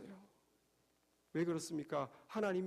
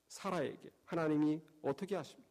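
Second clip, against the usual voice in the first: ordinary delivery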